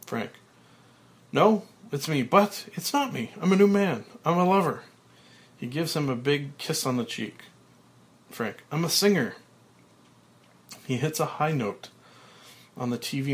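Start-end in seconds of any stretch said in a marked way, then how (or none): 1.3-4.8 high, loud beaming declarations; joyful. Acted. Frank joyfully reborn. 8.7-9.4 high, loud beaming declarations; joyful. Acted. Frank joyfully reborn.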